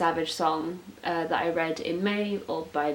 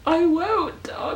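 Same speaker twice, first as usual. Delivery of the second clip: high, loud eager promise; excited reply; 12 semitones higher, 6 dB louder, slower than usual. Real, not acted. Fan answering the blurb's fairy lights line, thrilled.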